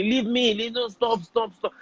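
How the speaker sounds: high, pleading imitation of a crying victim; crying plea. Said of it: "and they are crying".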